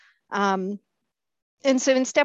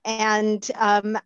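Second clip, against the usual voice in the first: normal talking voice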